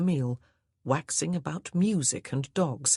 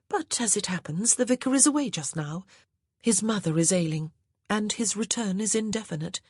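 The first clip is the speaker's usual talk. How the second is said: put-on higher, lilting young lady; higher ladylike voice; wife's voice; 5 semitones higher than usual. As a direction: sweetly suggest the wine. Wife character speaking.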